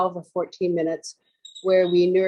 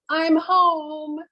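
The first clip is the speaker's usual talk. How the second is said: much higher, held-out delivery; theme announcement; 8 semitones higher than usual. Real, not acted. Yoga teacher announcing today's theme to the class, high and drawn out. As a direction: lift the phrase high and sustain it.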